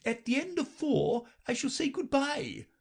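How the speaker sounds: very high brisk queen; matter-of-fact and a little impatient. A narrator's reading. Queen answers Alice, very high and brisk.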